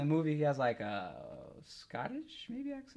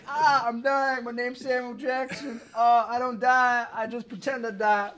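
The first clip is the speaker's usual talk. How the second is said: higher, loud hammed-up dying lines; mock Samuel Jackson death; 7 semitones higher, 6 dB louder than usual. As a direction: ham up a fake death.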